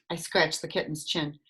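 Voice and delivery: ordinary delivery, nothing marked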